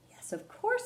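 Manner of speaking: a tiny high-pitched cat; Cat answering Ruby in a squeaky voice. Acted. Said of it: "said Cat".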